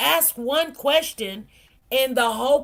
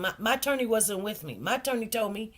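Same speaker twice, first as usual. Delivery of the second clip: normal talking voice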